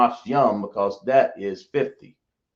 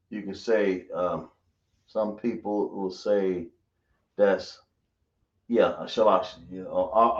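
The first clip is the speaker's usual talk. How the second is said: ordinary talk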